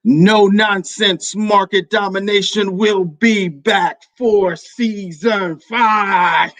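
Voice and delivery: triumphant shout. Real, not acted. Host revealing the show returns for season five, shouting in triumph. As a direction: blast the news out in triumph.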